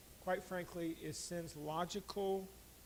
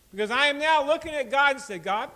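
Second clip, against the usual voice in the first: loud, much higher sermon voice; preaching; 8 semitones higher, 14 dB louder than usual. Acted. Pastor showing how to confess to God, loud preaching. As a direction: build it up to the room.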